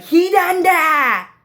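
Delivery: long, very high, loud wail; Sasuke's scream. Retold or imitated. Showing how Sasuke screams, loud drawn-out shriek. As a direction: scream it out high, stretching each syllable. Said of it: "he actually screams like that".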